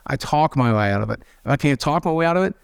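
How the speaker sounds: ordinary talk, nothing marked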